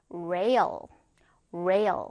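usual speaking voice

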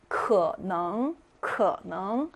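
可能 with 可 low and falling, then 能 rising; half third tone. Shown as a demonstration. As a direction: drop 可 without lifting it, then lift 能. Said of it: "음을 떨어뜨리기만 하고 올리지 않는 음인데".

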